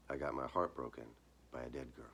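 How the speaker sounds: ordinary delivery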